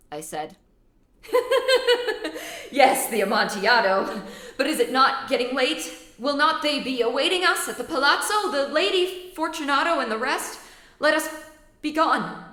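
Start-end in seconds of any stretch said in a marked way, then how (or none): 1.2-12.4 high, loud forced laughter as he speaks; nervous cackling plea; Fortunato laughing. Acted. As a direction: laugh it off with rising panic. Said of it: "It was succeeded by a sad voice".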